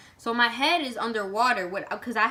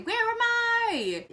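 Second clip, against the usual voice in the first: higher, slow, lost question; drawn-out confusion; 12 semitones higher, slower than usual. Retold or imitated. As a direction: stretch it out dazed. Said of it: "And I'm just like".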